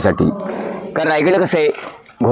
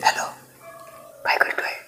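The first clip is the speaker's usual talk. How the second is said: hushed whisper, much softer; whispered demo; 13 dB quieter than usual. Shown as a demonstration. Guide shows how quiet talk stays inside, whispering. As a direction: whisper a secret plot. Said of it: "मी कसं बोलतोय बघा".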